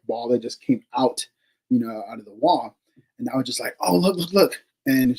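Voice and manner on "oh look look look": higher-pitched, mildly urgent re-enactment; urgent retold exclamation. Investigator tells partner about shape from the wall, mildly urgent. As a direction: lightly act out pointing something out. Retold sighting in the dark closet.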